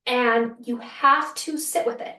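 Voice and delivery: normal talking voice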